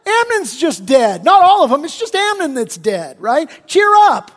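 mocking Jonadab cheering the grieving king up; mock comforting. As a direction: play a sleazy friend brushing off a death. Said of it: "trying to comfort him".

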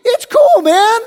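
mocking Jonadab cheering the grieving king up; mock comforting. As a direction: play a sleazy friend brushing off a death. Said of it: "trying to comfort him".

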